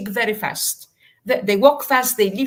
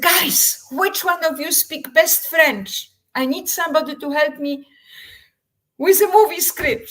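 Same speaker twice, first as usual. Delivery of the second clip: calling out; 7 semitones higher, 5 dB louder than usual. Retold or imitated. Calling out to schoolkids.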